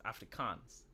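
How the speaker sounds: "Afrikaans" with a long "kahns" ending; soft, careful "Afrikaans", long final vowel; careful Afrikaans pronunciation. Shown as a demonstration. Checking with co-host it's not Africans, careful "Afrikaans". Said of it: "not Africans".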